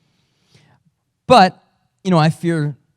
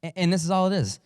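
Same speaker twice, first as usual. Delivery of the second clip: ordinary delivery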